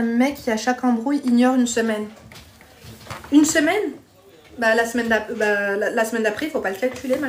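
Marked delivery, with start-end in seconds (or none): 3.3-3.9 higher, incredulous echo question; taken aback, questioning. Real, not acted. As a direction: repeat it back in disbelief, pitch lifting. Echoes the comment in disbelief.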